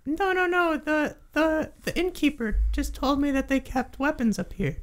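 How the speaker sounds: high, squeaky creature, stumbling; flustered kobold. Acted. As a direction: hastily correct them as a tiny kobold. Game master voices kobold.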